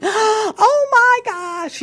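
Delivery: very high, drawn-out squeal; excited teenager. Acted. Showing clients how animated teens talk, excited squeal. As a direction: gush it slowly, hugely animated. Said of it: "that's what we should be doing".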